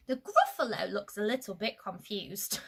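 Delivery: ordinary delivery, nothing marked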